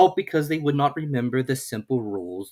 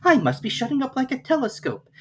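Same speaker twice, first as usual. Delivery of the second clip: put-on much higher, wondering girl; Alice's voice. A narrator's reading. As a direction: marvel at the odd feeling. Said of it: "said Alice".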